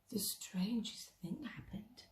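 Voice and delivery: hushed storyteller whisper; suspenseful whisper. A narrator's reading. Narrator building suspense for children, whispering. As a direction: draw the listener in with a whisper. Suspense before the worry shrinks.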